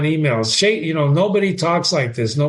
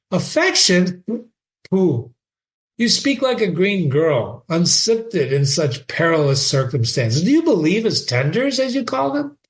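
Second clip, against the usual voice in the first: mocking, higher old father; scoffing father; 3 semitones higher than usual. Polonius mocking Ophelia's trust, scoffing father. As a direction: scoff at her naivety.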